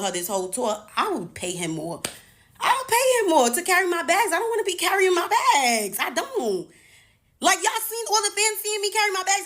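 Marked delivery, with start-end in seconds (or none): 2.6-9.4 much higher and louder, exasperated; worked-up rant. Real, not acted. Venting to viewers about carrying her own bags, loud and high. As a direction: let it burst out, fed up.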